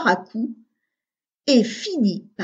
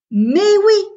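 much higher and louder burst of discovery; excited eureka; 10 semitones higher, 7 dB louder than usual. A narrator's reading. Augustin finds the solution in the dark, eureka shout. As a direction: shout the sudden idea. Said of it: "s'illumina d'un coup".

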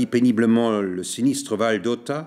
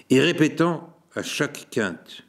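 plain reading voice

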